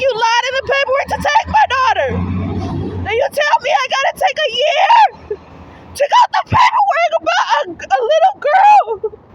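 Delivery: high, loud, breaking voice, slowing at the end; tearful shout. Real, not acted. Mother shouting at the caseworkers who kept her daughter, anguished shout. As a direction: shout it through sobs.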